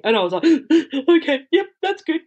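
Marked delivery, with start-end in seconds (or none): 0.5-1.0 gasp. Retold or imitated. Retold moment of fear. 1.1-2.2 high, tight nervous agreement; retelling a scared reply to her husband, high and tight; anxious high squeak. Retold or imitated.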